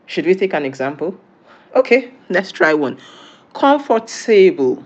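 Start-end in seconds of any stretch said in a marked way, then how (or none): 3.5-4.8 "comfortable" said slowly the English way, ending "tay-bull"; English pronunciation. Shown as a demonstration. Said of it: "in English".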